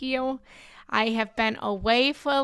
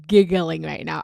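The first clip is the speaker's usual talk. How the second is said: ordinary talk, nothing marked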